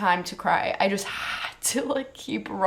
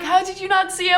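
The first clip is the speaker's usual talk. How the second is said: incredulous question; 10 semitones higher, 3 dB louder, faster than usual. Retold or imitated. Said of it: "They're like".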